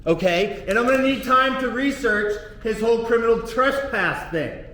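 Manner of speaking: usual speaking voice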